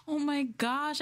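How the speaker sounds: high, startled oh my gosh; suddenly shocked by the news; shocked gasp. Real, not acted. Streamer reacting to news of a death, shocked.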